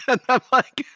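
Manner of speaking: ordinary talk, nothing marked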